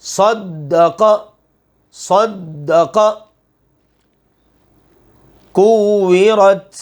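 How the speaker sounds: slow recitation with the doubled letters pressed firm; tajweed recitation. Shown as a demonstration. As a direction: recite each word stretched out, holding the shadda. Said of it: "سختی اس کے اندر پیدا کریں گے". (آہستہ تلاوت، شد پر سختی)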